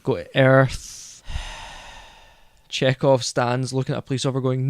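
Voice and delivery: usual speaking voice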